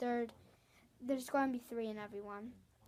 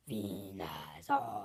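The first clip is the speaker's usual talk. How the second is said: a slow, much lower creature call; medium Venusaur sound; much slower than usual. Shown as a demonstration. Kid showing viewers his medium Venusaur, slow and deep. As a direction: drag the name out deep and steady. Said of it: "This is my medium sound".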